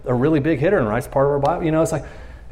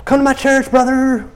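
a hint of a pushy churchgoer; 9 semitones higher than usual. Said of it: "and I'm getting".

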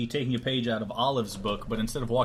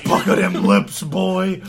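creepy voice; 5 semitones higher, 10 dB louder, slower than usual. Pretend. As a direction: growl it like a creep. Mock kiss threat.

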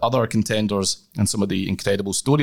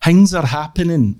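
mock dramatic; 6 semitones higher, 6 dB louder than usual. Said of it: "as they would say".

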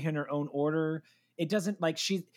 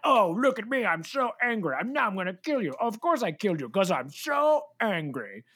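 mocking a villain who brags about being angry; 4 semitones higher than usual. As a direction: overplay the rage like a cartoon villain.

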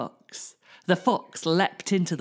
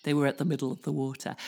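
usual speaking voice

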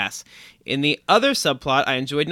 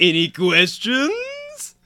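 mock voice; 8 semitones higher, much slower than usual. Mock repeating the question.

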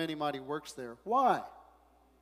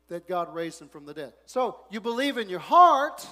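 ordinary talk, nothing marked

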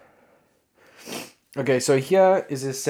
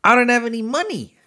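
higher, pleading whine; mock whiny self; 12 semitones higher, 5 dB louder than usual. Fan tells [name] he is broke, higher mock whine. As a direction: play the broke sidekick. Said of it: "And I was like".

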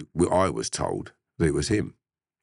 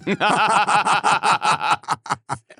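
loud, very high burst of laughter; helpless laughter; 6 dB louder than usual. Real, not acted. Storyteller laughing at his mate's acquittal, helpless laughter. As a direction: crack up completely, high and loud.

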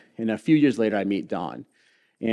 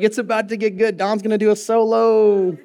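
high, loud, laughing hype; excited anticipation; 10 semitones higher, 6 dB louder than usual. Retold or imitated. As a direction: talk up the solo like a fan. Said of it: "I was like".

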